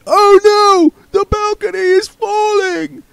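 distant actor crying that the balcony falls; panicked actor's cry. Acted. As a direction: shriek the warning from far off. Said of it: "A man on stage shouted".

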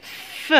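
"F" as a soft bare "fff" sound, without the letter name; just the consonant sound of "F", softly. Shown as a demonstration. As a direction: sound out "F" as a quiet hissed fff with a short release. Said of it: "Est-ce que هذا الف".

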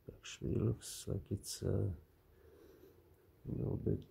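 whispered mumble; mumbling whisper. Real, not acted. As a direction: mumble it under your breath. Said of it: "I'm mumbling and I'm talking like this".

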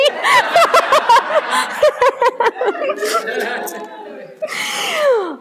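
long high-pitched laughing fit; helpless high laughter; real laughter. Real, not acted. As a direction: laugh hard and high, trailing down. Laughs at her own story.

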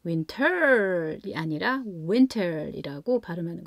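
"winter" with the first syllable WIN punched, said very slowly; stress on WIN. Shown as a demonstration. Teacher explaining English stress to parents, hitting WIN hard. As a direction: contrast a flat reading with a strongly stressed WIN. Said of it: "바로 강세입니다".

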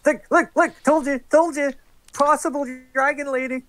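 high, loud look look look; high insistent nagging. Acted. Player's character tapping Lauda's leg, high and nagging. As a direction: nag up high like a pestering kid. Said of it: "basically go".

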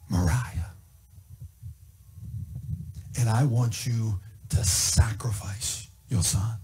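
low, slow breathy whisper of God; God whispering the grave command to Abraham; hushed whisper. Acted.

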